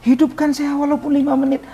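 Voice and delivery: high-pitched desperate cry to God; retold crying plea. Dead man begging Allah for minutes of life, crying out. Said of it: "saya berteriak".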